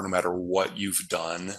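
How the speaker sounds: normal talking voice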